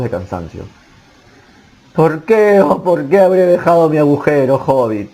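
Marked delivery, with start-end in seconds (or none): 1.9-5.1 woeful lament. A narrator's reading. Poor Bolsón bemoaning leaving his hobbit hole, woeful lament. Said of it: "decía el pobre señor Bolsón".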